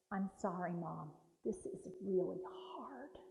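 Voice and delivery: hushed heartfelt apology to Mom; sincere apology. Shown as a demonstration. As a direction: say it like you truly mean it. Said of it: "And sound like you mean it".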